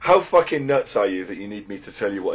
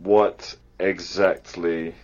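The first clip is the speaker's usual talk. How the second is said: measured, lower, word by word; slow dictation; 5 semitones lower, much slower than usual. Real, not acted. Reading out a message being typed to a stranger, slow dictation. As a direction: spell the message out slowly with pauses.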